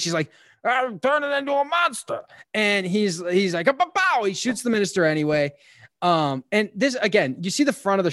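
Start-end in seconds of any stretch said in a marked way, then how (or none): 0.6-2.2 high, put-on monster voice; fanged monster. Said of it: "she's, like, got fangs". 3.7-4.2 high, punchy mock gunfire; imitated gunshots.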